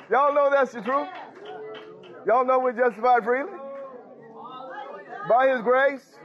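high-pitched call to the congregation; rousing preaching. Real, not acted. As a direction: preach it up toward a hallelujah.

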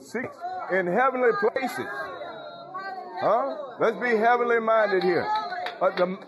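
ringing pulpit call; fervent preaching. Acted. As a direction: lift the congregation up. Preaching at full force.

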